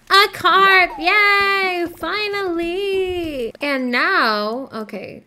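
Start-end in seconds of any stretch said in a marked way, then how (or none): 0.1-3.5 higher and louder triumphant cheer, last word slow and drawn out; excited cheer. Real, not acted. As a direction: celebrate the catch at last. Finally catches the carp.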